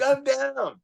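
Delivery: very high, drawn-out yes-I'm-in; high, giddy agreement; eager acceptance. Retold or imitated. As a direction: reenact jumping at the offer. His eager acceptance retold.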